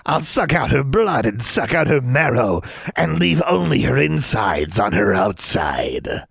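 slow, relishing creature voice; hungry swamp creature. Acted. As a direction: relish the threat like a ghoul.

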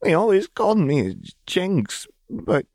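high, halting, tearful voice; tearful girl. A narrator's reading. Jinx telling Silco through tears, high and halting. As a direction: stumble through it near sobbing. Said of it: "She flinched".

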